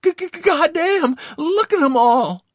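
high, loud stuttering outburst; awed exclamation. A narrator's reading. Dazed Victor seeing the massed enemy, exclaiming in awe. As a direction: stutter into a shrieked cry of awe. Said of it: "he exclaimed in awe".

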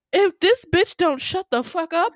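high, loud outraged outburst; exasperated mock threat. Viewer reacting to a character who won't stop, exasperated. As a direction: blurt it high and loud, utterly fed up.

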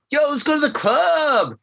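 put-on high-pitched excited party girl; young party girl. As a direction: pitch it way up like a club-happy girl. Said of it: "And the girl's like".

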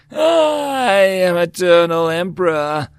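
booming, slow, high god-emperor voice; dark lord. A narrator's reading. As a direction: proclaim it slowly like a tyrant god. Emperor declares himself.

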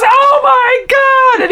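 drawn-out, high-pitched panicked wail; hammed-up horror, stretched out and very high; mock freak-out. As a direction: act out a comic meltdown. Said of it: "And he's freaking out".

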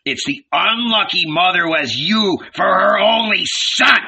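scathing telling-off; angry tirade. A narrator's reading. Furious old man berates carpenter's son.

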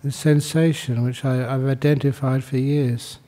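calm meditation guidance with long pauses; guided meditation. Acted. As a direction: guide them unhurriedly into stillness, pausing between phrases.

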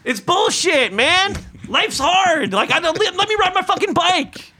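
high, worked-up comic tirade; heated rant. Acted. Cyclist rants about bad drivers, heated tirade. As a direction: rant at them in exasperation.

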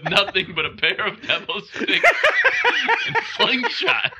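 high, laughing through the announcement; trying to announce while cracking up; laughing newsreel parody. Pretend.

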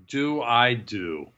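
drawn out at half speed; slow, stretched catchphrase delivery. Acted. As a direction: say it slowly like a signature catchphrase. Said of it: "Remember my catchphrase of".

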